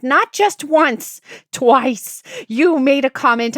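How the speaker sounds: loud, high, slow angry retort; retold fight with husband, loud and angry. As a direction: hammer each word at him.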